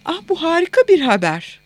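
high-pitched, pleased exclamation; happy motherly enthusiasm; delighted mother. A narrator's reading. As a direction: welcome the good news with lifted delight. Mother delighted at daughter's news.

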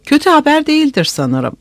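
narrated timid, quavering voice; timid goat-like quaver. A narrator's reading. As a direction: ask it shyly, like a quavering old aunt. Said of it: "keçi gibi titrek bir sesle".

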